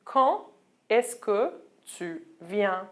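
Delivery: very slow, careful "Quand est-ce que tu viens?"; slow demo. Shown as a demonstration. Teacher slowly models a when-question in French. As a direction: lay out each word slowly for learners. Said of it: "I can say".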